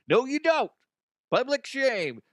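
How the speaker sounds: high, loud sing-song no you don't; mock high scold. Warning listeners not to linger, in a high mock scold. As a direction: jump way up and scold playfully.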